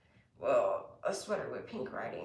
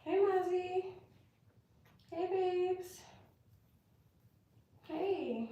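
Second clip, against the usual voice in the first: much higher sweet pet voice; pet talk; 14 semitones higher, much slower than usual. Real, not acted. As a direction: coo at the dog. Greeting the pet.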